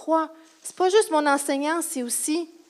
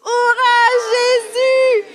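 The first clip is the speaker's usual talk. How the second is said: high, loud, drawn-out hurrah; shouted cheer; 10 semitones higher, 4 dB louder, much slower than usual. Shown as a demonstration. Teacher getting children to cry out for Jesus, shouted cheer. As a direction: lead the room in a big cheer. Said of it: "Est-ce qu'on peut crier?".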